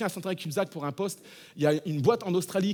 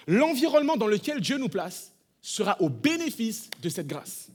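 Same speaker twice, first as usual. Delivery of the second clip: slow, louder, weighty proclamation; slow emphatic preaching; 4 semitones higher, 6 dB louder, slower than usual. Acted. Pastor proclaiming God's favour to the congregation, slow and emphatic. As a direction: lay each word down like a promise.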